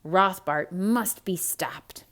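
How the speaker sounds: usual speaking voice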